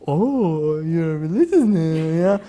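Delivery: slow, dragged-out disapproving drawl from down in the throat; mock a sour relative, words stretched; frowning family member. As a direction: speak it from down there, frowning. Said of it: "they're speaking from, like, down there".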